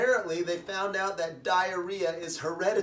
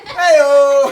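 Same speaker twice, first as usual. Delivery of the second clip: loud, drawn-out "hey-o"; punchline catchphrase; 8 semitones higher, 16 dB louder than usual. Acted. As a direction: call it out big to cap the joke. Comedian's shouted punchline catchphrase.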